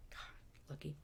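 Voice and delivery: a private envious whisper; whispered envy to the camera; whispered aside. Real, not acted. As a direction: breathe it out under your breath.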